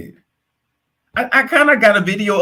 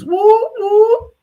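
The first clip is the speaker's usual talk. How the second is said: high-pitched siren whoop; two high, loud rising whoops; mock police siren; 5 dB louder than usual. Joking about calling the police, mock siren whoops.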